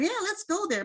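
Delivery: high-pitched retold eagerness; kind of excited. Recalling agreeing to join the trip, excited. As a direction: pitch it up, recalling your keen yes. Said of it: "kind of excited going".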